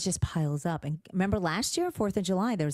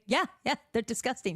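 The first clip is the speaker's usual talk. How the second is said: mock agreement; 7 semitones higher than usual. Mother's retold reply to panicked daughter.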